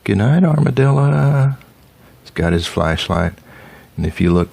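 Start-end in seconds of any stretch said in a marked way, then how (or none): 0.0-1.6 higher sing-song goodnight; zookeeper's higher goodnight. Acted. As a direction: tuck the armadillo in with your voice. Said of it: "and he says".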